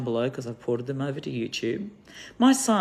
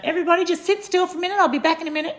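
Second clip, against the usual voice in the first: much higher, fast sing-song teacher voice to the class; bossy teacher voice; 6 dB louder, much faster than usual. Retold or imitated. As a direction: chirp it out like a teacher hushing kids. Teacher addresses her class.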